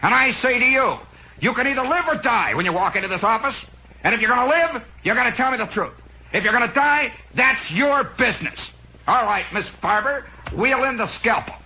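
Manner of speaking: browbeat the patient as an overblown TV doctor; Casey. Acted. TV doctor berating a patient in his office, mock yelling. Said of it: "Casey's always yelling at people".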